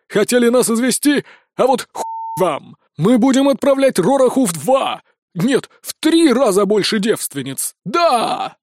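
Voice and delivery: high, angry defiant ranting; shouting after them. A narrator's reading. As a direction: yell defiance at the escapees. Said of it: "кричал нам вслед староста". (злобно крича вслед беглецам)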